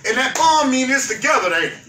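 usual speaking voice

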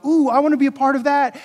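eager church member. Acted. Pastor imagining people hearing his vision, eager. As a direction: act out someone keen to join.

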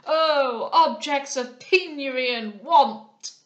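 slow, high, thundering lament; loud exclamation. A narrator's reading. Old moralist interrupting the captain's invitation, loud and declamatory. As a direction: cry out to the poor, drawing each word long. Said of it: "again exclaimed the incognito".

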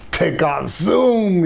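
swooping high on "zoom"; animated zoom. Retold or imitated. Storyteller showing the cat racing to the phone, animated swoop. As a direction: act out a sudden dash, pitch leaping up.

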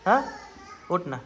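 ordinary delivery

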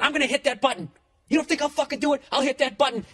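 the drunk daring him, hammed up; mock drunk threat. Drunk seatmate threatening to press the call button, mock angry.

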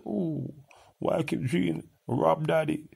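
high, whiny mock of someone asking. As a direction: squeal it like a teasing whiner. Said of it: "And say".

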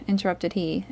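ordinary delivery, nothing marked

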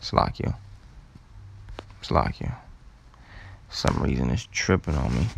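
unvoiced whisper to someone nearby; whispered aside. Real, not acted. To Salakia, a quick whispered aside. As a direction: hush it off mic.

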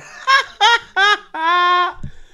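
high burst of gleeful laughter. Real, not acted. Fan laughing at rattled City, high glee. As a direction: cackle at the rivals. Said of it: "Oh, that's hilarious".

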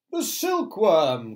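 high, loud, drawn-out announcement; exclaiming old grasshopper; triumphant cry. A narrator's reading. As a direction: proclaim the answer slowly. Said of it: "cried the old green grasshopper".

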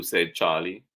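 plain reading voice

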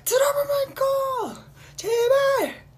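high, loud, tearful begging; shrill sobbing plea from an adoring fan; pleading fan. Retold or imitated. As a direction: beg like a desperate crying fan. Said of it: "세븐틴 사랑형".

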